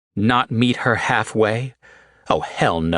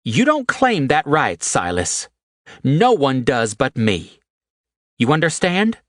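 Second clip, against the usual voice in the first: fierce; 7 semitones higher than usual. A narrator's reading. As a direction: demand he understand. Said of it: "she said fiercely".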